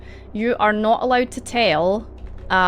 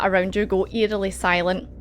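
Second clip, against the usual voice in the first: usual speaking voice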